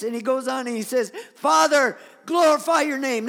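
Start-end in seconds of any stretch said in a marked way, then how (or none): 1.3-3.3 loud, high shout to heaven; intense cry. Acted. Jesus cries out to his Father, intense shout. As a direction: call out to the Father at full force. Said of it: "this is intense".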